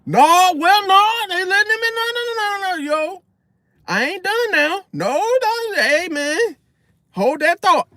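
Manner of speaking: very high, loud no no no; loud talking over. Shown as a demonstration. Showing how he talks over people who interrupt, high and loud. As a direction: keep firing nos over the other person. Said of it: "just loud as hell".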